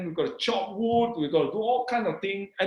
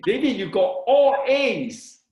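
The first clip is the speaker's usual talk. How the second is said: higher, louder, slow reveal; retold excited friend, voice raised; breaking big news; 6 semitones higher, 6 dB louder, slower than usual. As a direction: draw out the amazing news. Friend reveals surprising top grades.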